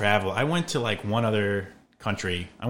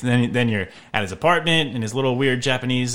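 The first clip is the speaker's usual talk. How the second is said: ordinary delivery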